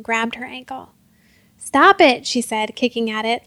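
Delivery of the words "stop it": high, loud command; sharp, urgent cry; struggling protest. A narrator's reading. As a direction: fight off an attacker. Girl kicking at grabbing hand.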